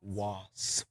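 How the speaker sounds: WASP said as "wawss", drawn out; deliberate pronunciation. Shown as a demonstration. Guest shows the mocking host how they say it, drawn-out "wawss". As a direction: say WASP slowly as "wawss", softly. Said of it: "laughing at when you say".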